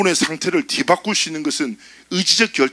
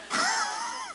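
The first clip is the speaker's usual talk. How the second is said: high-pitched laugh; 9 dB quieter than usual. Real, not acted. Laughing at own story.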